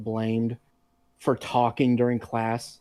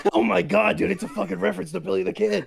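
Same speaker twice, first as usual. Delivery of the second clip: laughing through the words; 5 semitones higher than usual. Real, not acted. To a friend after his pun, laughing. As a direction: crack up while talking.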